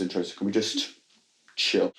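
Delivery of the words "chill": normal talking voice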